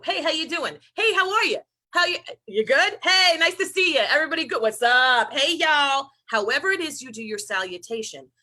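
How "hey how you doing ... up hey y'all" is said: chirpy, high and loud passing hellos; breezy face-value greetings. Retold or imitated. Mocking how Americans greet each other, high bouncy hellos. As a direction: toss off bright, hollow greetings like in a hallway. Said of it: "this salutation that's quite face value".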